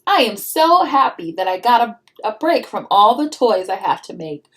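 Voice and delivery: lively storybook read-aloud; reading to children. A narrator's reading. Mom reading a picture book to her child, storybook voice.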